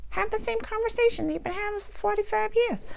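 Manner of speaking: high, thin tempting voice calling out; nagging inner voice. Pretend. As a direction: play the little voice that keeps pestering you. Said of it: "It's probably gonna sound like that".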